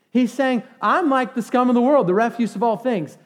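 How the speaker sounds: loud, high, fired-up preaching; excited preaching. Real, not acted. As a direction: pump up the room, high and loud. Preacher rousing the congregation.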